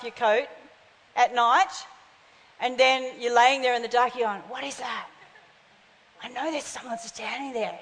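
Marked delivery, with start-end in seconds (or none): 4.5-7.8 hushed, as if someone might hear; fearful hush. Acted. Acting scared child lying in dark.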